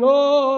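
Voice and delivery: high, loud mock mourning wail; a hammed-up mourner's wailing cry. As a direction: ham up a professional mourner's wail. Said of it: "one minute they're wailing".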